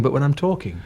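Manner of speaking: ordinary delivery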